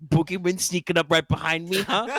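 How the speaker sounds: high, laughing sneer; mocking guard voice. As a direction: scoff at the idea.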